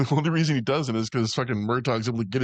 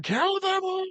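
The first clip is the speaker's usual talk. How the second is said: squeaky high hammed-up cry of "Kill them all!"; mock shriek; slower than usual. Friend in the lobby corner, squealing mock shout.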